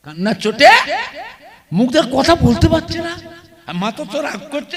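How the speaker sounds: ordinary delivery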